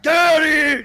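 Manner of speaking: sudden high, loud yell; explosive shout imitating Dad; mock angry yell. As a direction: blow up like an irate dad. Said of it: "He just starts yelling at me".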